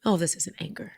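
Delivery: soft, hushed dawning awareness; quiet, gentle admission; tender realization. Acted. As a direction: softly voice an inner discovery. Voicing body's tender realization.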